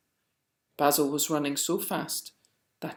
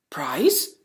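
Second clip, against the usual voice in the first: surprised whisper; 5 dB louder than usual. A narrator's reading. Basil astonished at award.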